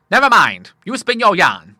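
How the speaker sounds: put-on loud, high old seaman; hearty command. A narrator's reading. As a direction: brush it off boisterously. Admiral brushes off son's question.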